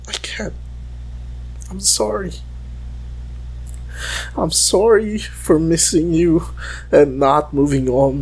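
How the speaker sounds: ordinary delivery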